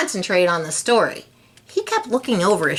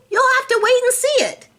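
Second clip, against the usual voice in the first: teasing singsong; faster than usual. Acted. Gilbert teasing Lewis.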